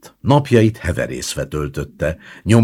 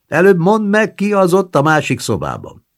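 put-on high, louder old woman demanding; won't be put off, pitched up; insistent grandmother; 7 dB louder than usual. A narrator's reading. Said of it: "grandmother insists".

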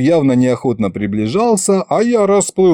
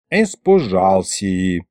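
low, drawn-out knowing remark; 4 semitones lower, much slower than usual. A narrator's reading. Grandpa sees why the governor hides, knowing drawl. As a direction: stretch it out as if you understand perfectly. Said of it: "понятливо кивнул дед".

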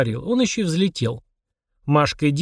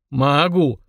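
drawn-out, bored demon. A narrator's reading. Demon answering whether he can build a house, bored. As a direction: drag it out with no interest. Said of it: "скучно отвечал Фурундарок".

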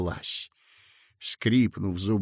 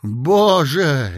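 louder joyful outburst; jubilant exclaim; 3 semitones higher, 7 dB louder than usual. A narrator's reading. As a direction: cry out in sheer relief. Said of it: "ликуя воскликнул Коротков". (ликуя)

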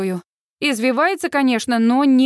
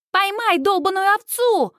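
high, mocking British-accent echo; mimicked British accent; 8 semitones higher than usual. A narrator's reading. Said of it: "идеально копируя британский акцент".

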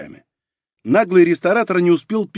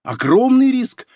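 slow, high, weighty emphasis; meaningful; 9 semitones higher, slower than usual. A narrator's reading. Anna joining the bluff to scare clients, meaningfully. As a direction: stretch it out high, heavy with significance. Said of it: "многозначительно произнесла Анна, включившись в игру".

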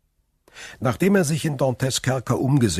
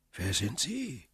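distant cautious voice; 5 semitones higher, 9 dB quieter than usual. A narrator's reading. Hidden old prisoner asks back from the tunnel, soft and guarded. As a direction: ask it back warily from far below. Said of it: "fragte die Stimme".

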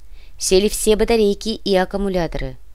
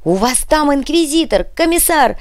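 high frantic shout, louder and louder; desperate shouting over radio; 7 semitones higher, 5 dB louder than usual. A narrator's reading. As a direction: yell an urgent warning down the radio. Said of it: "Рация отчаянно надрывалась".

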